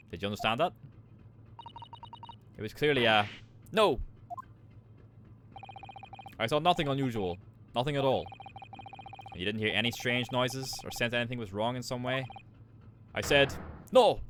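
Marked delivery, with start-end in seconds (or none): 3.7-8.2 angry Russian denial. Acted. 13.1-14.2 put-on loud snapping Russian-accented "no!"; angry Russian shout. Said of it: "S-, sorry".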